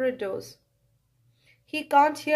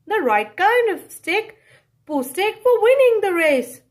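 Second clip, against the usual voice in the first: put-on higher, louder eager girl's voice; 6 semitones higher, 5 dB louder than usual. Girl by the river, delighted with her find. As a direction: sell it as the perfect stick. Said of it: "cries the girl".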